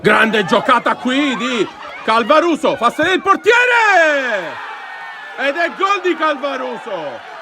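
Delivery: goal shout, drawn out. Real, not acted. Goal scored, live commentary.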